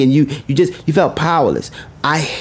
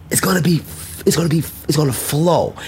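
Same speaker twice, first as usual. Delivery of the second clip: whisper; 2 semitones lower, 4 dB quieter, faster than usual. Real, not acted. Motivational speech, whispered aside.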